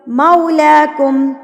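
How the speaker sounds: slow मौलाकुम, opening on a gliding "mau"; rounded mau diphthong. Shown as a demonstration. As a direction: glide from a to oo in मौलाकुम, lips rounded. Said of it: "होंठों को बिल्कुल गोल कर लें".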